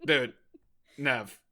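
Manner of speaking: ordinary delivery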